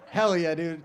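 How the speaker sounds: ordinary delivery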